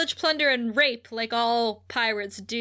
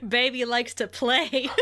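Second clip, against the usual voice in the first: mock creepy purr. Catchphrase quote, far voice.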